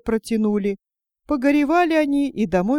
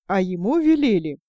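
plain reading voice

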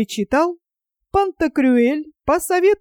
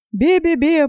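higher, wailing бе-бе-бе; crying bleat; 5 semitones higher than usual. Acted. Panurge wails as he swallows seawater. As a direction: bleat and sob it out. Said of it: "плакал Панурк".

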